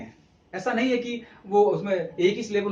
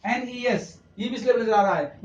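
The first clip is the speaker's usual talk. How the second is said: usual speaking voice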